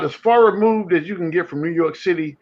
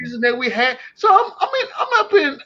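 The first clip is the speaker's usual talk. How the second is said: high, cracking excited shout; 7 semitones higher than usual. Real, not acted. Knicks fan raving about the season, excited shout.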